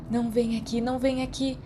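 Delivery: a panicked thought kept under the breath; frantic thought. A narrator's reading. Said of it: "Remus pensou freneticamente".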